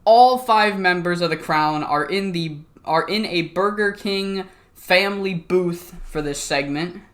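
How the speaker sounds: ordinary talk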